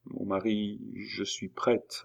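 soft, slow, tearful murmur; hushed, hesitant tearful assent; stammered through tears. A narrator's reading. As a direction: falter it very softly, close to tears. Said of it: "balbutia Julie parmi ses larmes".

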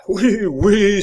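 high, loud triumphant cry; exultant. A narrator's reading. Lambert cheers as the bars break, triumphant cry. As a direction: burst out in triumph. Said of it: "dans l'exaltation de son triomphe".

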